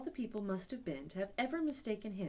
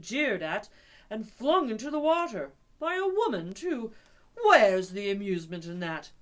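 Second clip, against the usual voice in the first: a lower, louder scolding friend; stern Rat lecturing; 4 semitones lower, 7 dB louder than usual. Acted. Rat scolding Toad for stealing cars, lowered and loud. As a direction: lay into him, exasperated.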